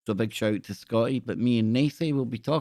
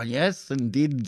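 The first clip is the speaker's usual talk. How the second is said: normal talking voice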